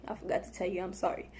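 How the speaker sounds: ordinary talk, nothing marked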